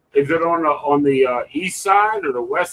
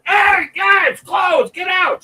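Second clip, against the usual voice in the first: high, loud lifeguard yell; lifeguard shouting warnings; 3 dB louder, much slower than usual. Retold or imitated. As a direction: bellow it across the lagoon. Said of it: "you hear a lifeguard".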